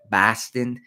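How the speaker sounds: Boston said "Bahston" in local accent; attempted Boston accent. Shown as a demonstration. Vlogger playfully repeats the city's name, hammy Boston accent. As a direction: try a broad Boston accent on "Boston". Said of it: "I'm not gonna do the accent".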